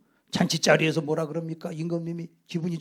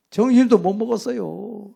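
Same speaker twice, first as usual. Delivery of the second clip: ordinary talk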